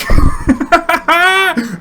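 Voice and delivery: high, loud gloating laugh; mocking laugh. Player gloating after juking his opponent, high mocking laugh. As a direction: laugh right in his face. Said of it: "And then I laughed at him".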